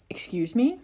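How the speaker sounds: high, incredulous question. Acted. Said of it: "his voice high and incredulous".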